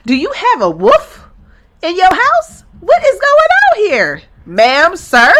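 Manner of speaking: high, loud mock-outraged parent; indignant confrontation. Husband confronting the neighbor's daddy, indignant. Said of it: "go out there and talk to the daddy and be like".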